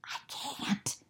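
soft, breathless whisper. Acted. Franklin answering his friends in a whisper. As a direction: barely voice it, sad. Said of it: "whispered Franklin".

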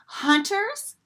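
high surprised question; alarmed question. A narrator's reading. Duckling hearing about hunters, high startled question. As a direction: ask it in sudden alarm. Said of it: "asked Simon".

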